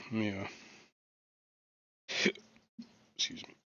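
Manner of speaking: talking through hiccups; hiccuping mid-sentence. Real, not acted. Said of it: "I got the hiccups".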